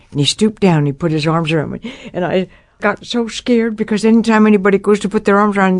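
ordinary talk, nothing marked

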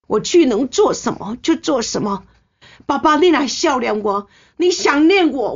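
kneeling daughter-in-law begging for his blessing, high and tearful; tearful pleading. Retold or imitated. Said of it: "我就跪着一直哭".

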